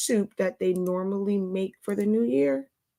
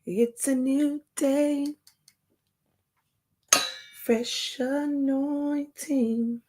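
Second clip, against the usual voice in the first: drawn-out greeting; 5 semitones higher, much slower than usual. Acted. Slow breathy opening greeting.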